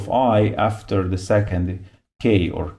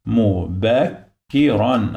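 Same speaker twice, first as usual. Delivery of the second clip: "mubakkiran" slow, in separate syllables; slower than usual. Shown as a demonstration. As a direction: sound out "mu, bak, ki, ran" slowly. Teacher breaks word into syllables.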